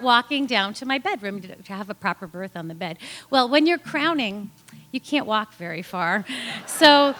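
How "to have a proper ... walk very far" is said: ordinary delivery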